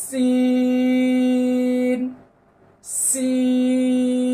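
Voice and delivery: long sustained সিন, hissed s; Arabic letter name. Shown as a demonstration. Teacher recites the letter for the class, drawn-out. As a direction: draw out সিন slowly. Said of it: "একই জায়গা থেকে".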